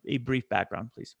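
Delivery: ordinary delivery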